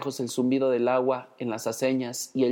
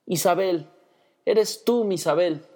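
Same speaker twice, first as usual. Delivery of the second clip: high, tender, wondering words; tearful tenderness; 6 semitones higher, slower than usual. A narrator's reading. Brother hugging his sister, a touch of tearful tenderness. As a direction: embrace her with tender tears. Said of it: "con tiernas lágrimas".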